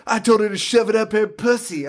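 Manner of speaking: put-on girl voice, high; mock teenage girl. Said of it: "the teenage girls are talking".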